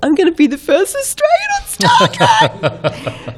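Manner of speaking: very high, drawn-out excited squeal; over-eager squeal. Acted. Excited actress announcing her Stargate role, hammed-up squeal. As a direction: squeal it slowly in a put-on high voice.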